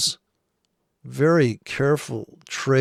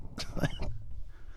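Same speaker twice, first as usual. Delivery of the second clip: high breathy laugh; 14 dB quieter than usual. Real, not acted. Laughing at the traders.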